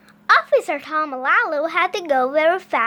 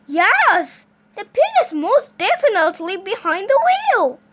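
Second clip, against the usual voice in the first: higher, loud, astonished certainty; emphatic police officer; 6 semitones higher, 6 dB louder than usual. Acted. Officer confirms a pig is driving, loud emphatic voice. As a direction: answer yourself with firm emphasis.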